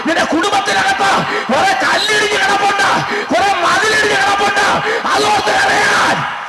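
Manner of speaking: preacher's climax over broken walls; climactic preaching. Acted. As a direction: drive it home like a revival preacher.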